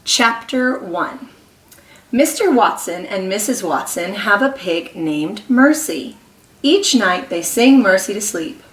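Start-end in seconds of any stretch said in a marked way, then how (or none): none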